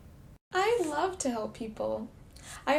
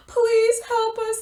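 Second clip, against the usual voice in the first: slow pleading croak; 9 semitones higher, 9 dB louder, slower than usual. Acted. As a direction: beg with a sore throat. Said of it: "they croaked".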